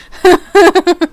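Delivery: short, high, loud laugh; amused laugh. Real, not acted. Streamer admitting following people for being Scottish, amused laugh. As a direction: burst out laughing at yourself.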